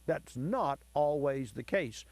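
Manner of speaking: slow, with weight on NOT; stress on NOT. Real, not acted. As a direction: underline NOT as you say it. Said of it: "Underline the word".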